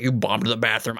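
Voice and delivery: a hint of a teasing coworker; mock sound guy. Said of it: "I don't know how he talks".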